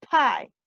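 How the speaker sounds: "Pie" with an aspirated, popping P; English "Pie", explosive first letter. Shown as a demonstration. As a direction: puff air out on the P of "Pie". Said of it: "Mucho más explosivo".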